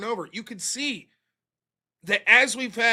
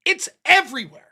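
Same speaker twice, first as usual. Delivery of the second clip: normal talking voice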